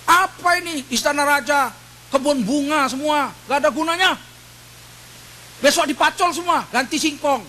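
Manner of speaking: lightly voiced peasant-turned-king. Mock king grumbling about flowers. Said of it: "dia bilang apa?".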